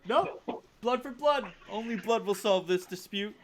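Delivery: high, stubborn demand for blood; vengeful refusal. Acted. Duelists rejecting the seconds' offers, high and insistent. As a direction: refuse every offer flatly.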